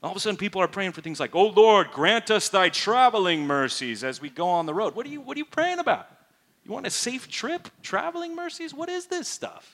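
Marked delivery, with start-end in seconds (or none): 1.3-4.9 much louder, swooping churchy prayer voice; mocking lofty prayer phrases; mock pious prayer. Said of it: "the inflection on their voice changes". 4.9-9.7 much higher, baffled disbelief; incredulous, mocking churchy phrasing. Real, not acted.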